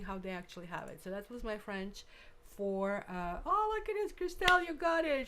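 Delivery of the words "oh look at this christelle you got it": high, loud burst of delight; thrilled, pitch leaping right up; delighted surprise. Real, not acted. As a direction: exclaim with real excited praise. Delighted at a viewer's correct answer.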